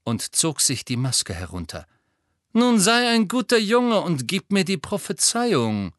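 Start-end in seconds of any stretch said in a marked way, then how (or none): 2.5-5.9 higher, silky coaxing menace; smug, patronising demand; condescending villain. A narrator's reading. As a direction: talk down to a boy you have trapped. Malfoy demands the prophecy.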